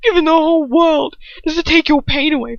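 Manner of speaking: usual speaking voice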